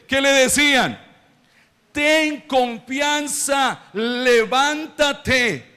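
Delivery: high, slow, drawn-out scripture proclamation; declamatory preaching. Real, not acted. Preacher proclaiming Jesus's call to the blind man, declamatory. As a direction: stretch each word out and proclaim it.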